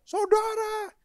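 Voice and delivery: sudden high, loud shout of a fiery preacher; hammed-up pulpit yell; mock preacher's yell. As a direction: bellow it like a pastor scaring off demons. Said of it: "dia teriak, setan aja takut".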